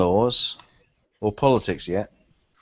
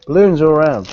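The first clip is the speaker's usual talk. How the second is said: excited announcing; 6 semitones higher, 8 dB louder than usual. Acted. Announcing party balloons loudly.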